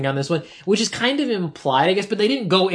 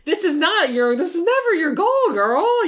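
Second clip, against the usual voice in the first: much higher, mocking sass at the villain "girl"; mock scolding; 12 semitones higher, slower than usual. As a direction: scold her in a high mocking voice.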